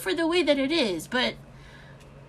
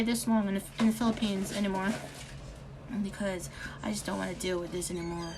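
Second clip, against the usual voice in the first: whisper; 6 semitones lower, 9 dB quieter, faster than usual. Real, not acted. Whispering to viewers, fed up with the Philippines. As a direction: drop to a tired whisper.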